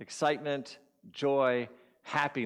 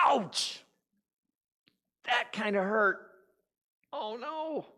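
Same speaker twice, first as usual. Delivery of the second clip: high-pitched comic hurt; yelp, then whine in pretend pain; mock pained yelp; 10 semitones higher, slower than usual. Barefoot walker stepping on a branch, mock pain.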